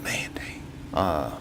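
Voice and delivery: breathy hushed whisper. Retold or imitated. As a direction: whisper it to yourself in dismay. Frustrated inner thought, retold.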